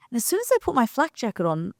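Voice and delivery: usual speaking voice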